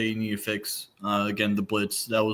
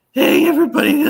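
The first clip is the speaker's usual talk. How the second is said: much higher, strained lost-voice croak; hoarse voice; 10 dB louder than usual. Retold or imitated. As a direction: squeeze it out like your voice is gone. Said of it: "I lost my voice and everything".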